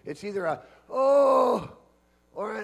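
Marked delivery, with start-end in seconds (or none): 0.9-1.7 loud, high "oh" groan; groan of pain. Shown as a demonstration. Preacher showing how we groan in pain, loud groan. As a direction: demonstrate a pained open-vowel cry. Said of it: "when we groan in pain".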